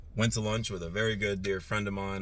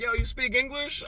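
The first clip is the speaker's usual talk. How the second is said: much higher, sneering yell; retold yelling; 3 dB louder than usual. Driver through rolled-down window at blocking car, mocking yell. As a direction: yell it out the truck window in disbelief. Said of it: "and of course I'm yelling".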